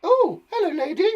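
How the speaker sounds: very high, loud hello; put-on greeting voice. Greeting a lady on the slide, silly high voice.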